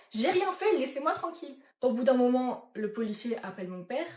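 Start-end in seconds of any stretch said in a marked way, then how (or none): 0.1-1.6 higher-pitched retold outburst of indignation; exasperated protest. Suspect pushing back at police pressure, exasperated. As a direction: protest your innocence, voice jumping up.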